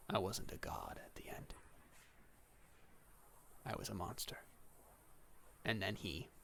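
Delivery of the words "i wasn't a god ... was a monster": soft, low near-whisper; hushed, defeated admission in character; dying confession. Acted. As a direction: confess it quietly with your last breath. Dying god's confession, acted.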